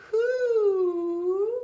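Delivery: long, high, loud owl hoot. Shown as a demonstration. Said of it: "listen to how I'm saying that".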